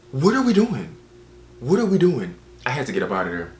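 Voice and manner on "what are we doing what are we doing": high, loud baffled outburst; raised-pitch, incredulous demand; exasperated disbelief. Acted. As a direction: cry it out in comic disbelief.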